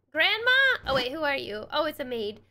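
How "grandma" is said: high startled question; surprised call. Acted.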